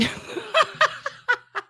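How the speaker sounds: shrill burst of laughter, high up; high squealing laugh. Real, not acted. Laughing at the [name] question, a high squeal.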